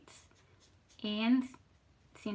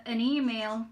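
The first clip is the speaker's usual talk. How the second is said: ordinary delivery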